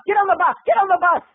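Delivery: high-pitched, insistent command; mock urgent order. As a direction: order someone aboard in a high voice. Walkie-talkie man orders her aboard.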